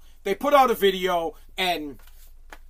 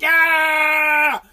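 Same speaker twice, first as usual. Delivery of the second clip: louder, higher defense cheer; mock team yell; 5 semitones higher, 6 dB louder than usual. The whole defense cheering a big hit, a louder, higher roar. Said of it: "The whole D's like".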